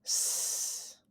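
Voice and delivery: "S" as a long voiceless hiss, not the letter name; S sound. Shown as a demonstration. Teacher showing the cedilla's sound, a long soft hissed "S". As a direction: hold "S" as a soft sustained sss. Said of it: "sound like an S".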